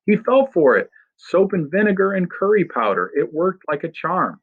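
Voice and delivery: put-on boy gloating in giddy high spirits; manic glee. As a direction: crow with wild delight. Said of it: "Freak is laughing like a maniac".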